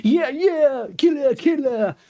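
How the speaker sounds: high, hammed-up victory whoop; mock cheering. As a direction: mimic the music celebrating a death, sarcastically. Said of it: "but it's being like".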